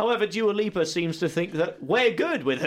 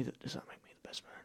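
hushed secret whisper; 21 dB quieter, much faster than usual. Acted. Secret wedding news, whispered.

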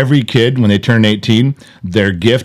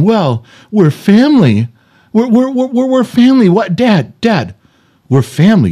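put-on pleading kid; 9 semitones higher than usual. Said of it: "the kids always tell me the same thing".

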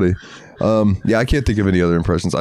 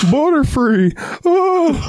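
much higher, cry-laughing squeal; slower than usual. Retold or imitated. Quoting the cartoon, high laughing cry.

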